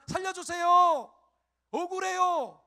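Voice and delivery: high, loud cry for help, mimicking a panicked believer; hammed-up wail of someone begging to be saved; mock desperate plea.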